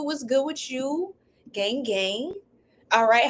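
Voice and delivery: ordinary delivery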